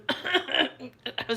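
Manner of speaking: mock cover-up coughing fit; cough hiding laughter. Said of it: "something in my throat, not laughing".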